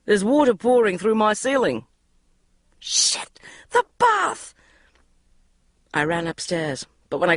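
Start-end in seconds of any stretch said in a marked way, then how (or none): 0.0-1.9 shouted. Acted. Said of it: "he shouted". 2.8-4.5 sudden high alarmed cry; panicked realisation. Acted. Bridget remembering the overflowing bath, alarmed cry.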